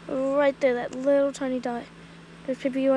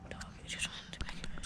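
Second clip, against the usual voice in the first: hushed whisper to the camera; 19 dB quieter, faster than usual. Real, not acted. Whispering to the viewers about the dog. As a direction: breathe it out unvoiced so nobody hears.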